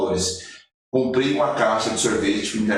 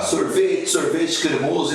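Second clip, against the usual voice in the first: higher-pitched ice-cream hawker's call; retold vendor's cry; 7 semitones higher, slower than usual. Boy selling ice cream to passersby, mild retold vendor's cry. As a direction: call it out like a street vendor, without full shouting. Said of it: "Eu comecei a gritar".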